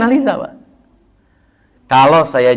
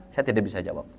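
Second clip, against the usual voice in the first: much softer, lower, humbly declining; humble quiet reply; 7 semitones lower, 13 dB quieter, faster than usual. Retold or imitated. Scholar humbly declining to answer, softly and low. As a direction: murmur it low like a modest scholar. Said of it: "mereka akan bilang".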